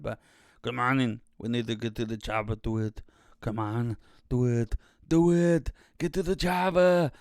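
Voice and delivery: hammed-up film hero urging everyone on; mock action-hero shout. Shown as a demonstration. Mic test with shouted film quote.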